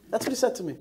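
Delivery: ordinary delivery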